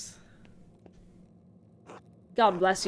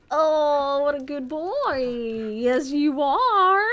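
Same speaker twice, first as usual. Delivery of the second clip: put-on higher, slow, drawn-out baby talk to a pet; doggy talk; 4 semitones higher, 6 dB louder, much slower than usual. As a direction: coo over a good boy, slowly. Talking to the game dog.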